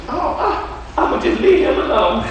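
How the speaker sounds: high, squeaky mock devil backing off; devil's meek voice, sending up his bluff. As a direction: lightly play the devil pretending to give up.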